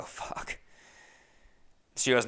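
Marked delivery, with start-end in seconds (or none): 0.0-0.6 a defeated curse whispered under the breath; muttered under the breath in defeat. Retold or imitated. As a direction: breathe the word out with no voice, very soft. No more flights tonight.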